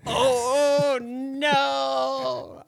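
high, loud, drawn-out "oh no"; mock wail. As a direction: wail in exaggerated dismay. Mock dismay at sponsor suggestion.

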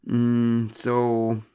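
drawn-out leading hum; prompting. A narrator's reading. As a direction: nudge the other person to continue. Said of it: "I prompted in lieu of".

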